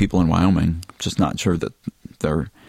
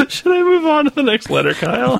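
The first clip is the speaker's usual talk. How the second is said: laughing. Real, not acted. Laughing, pitch up after laugh fit.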